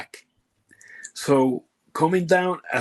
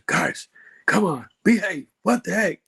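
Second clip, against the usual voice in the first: scolding supervisor; 5 semitones higher than usual. Retold or imitated. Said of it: "supervisors come down and say".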